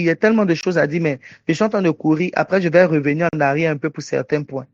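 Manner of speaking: fast, hurried speech; racing through the words to save time; rushing. Real, not acted. (débit précipité, très rapide)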